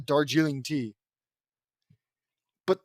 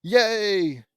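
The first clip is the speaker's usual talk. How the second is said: mock amazement; 4 semitones higher, slower than usual. Reader mocking praise for J D's joke, mock amazement. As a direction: fake being thrilled, ending on a stretched cheer. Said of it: "you know how it was all like".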